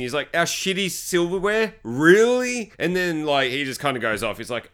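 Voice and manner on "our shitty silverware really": slow, raised scoffing disbelief; mock incredulous. Husband dismissing wife's missing cutlery, mock incredulous. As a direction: draw it out in mocking disbelief.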